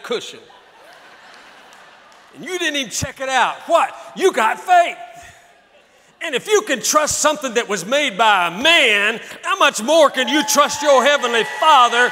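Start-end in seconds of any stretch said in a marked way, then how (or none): 2.3-12.1 louder, higher, shouted preaching; full-force preaching. Acted. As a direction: hurl it at the devil from the pulpit.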